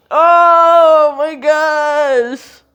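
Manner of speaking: slow, loud, stretched-out amazement; stunned oh my gosh. Real, not acted. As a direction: drag it out in disbelief. Stunned after the adjustment.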